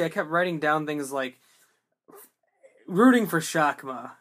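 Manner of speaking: ordinary talk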